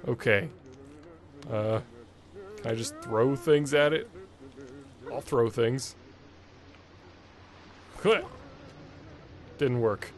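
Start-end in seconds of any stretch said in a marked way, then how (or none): none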